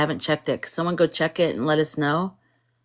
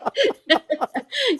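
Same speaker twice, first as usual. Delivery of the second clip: high laugh. Real, not acted. Laughing at own rant.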